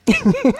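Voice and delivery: high-pitched laugh. Real, not acted. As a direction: laugh high after the punchline. Teller laughs at own joke.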